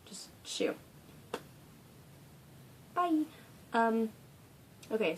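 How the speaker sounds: usual speaking voice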